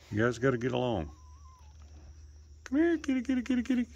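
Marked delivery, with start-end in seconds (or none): 2.6-3.9 high sing-song kitty call; high cat call. Real, not acted. Owner calling the cat in a high sing-song. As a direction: coax the cats in up high.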